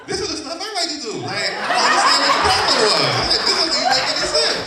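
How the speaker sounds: high, loud, fast outrage with laughs; incredulous outburst. Real, not acted. Comedian telling the crowd about her profile, loud and high. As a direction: rant in laughing disbelief.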